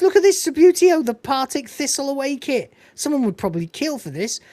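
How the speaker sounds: high, loud mock enthusiasm; retold excitement. Lister showing off Subbuteo kit, acted excitement. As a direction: show off a treasure, pitched well up. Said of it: "He goes".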